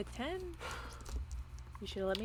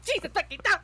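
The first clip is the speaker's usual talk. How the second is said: higher, louder in-character swearing; acted exasperated outburst; 6 semitones higher, 4 dB louder than usual. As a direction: play an appalled reaction. Acting out companion's shocked reaction.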